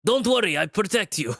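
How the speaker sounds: lightly done Russian accent; a hint of a big protector's Russian accent. Shown as a demonstration. Lion reassures the player in a mild Russian accent. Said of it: "my Russian accent".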